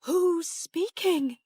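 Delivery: high, loud, slow alarmed question; startled and fearful, pitched high; frightened. A narrator's reading. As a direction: call out in fright to an unseen voice. Startled aunt hears a ghostly voice.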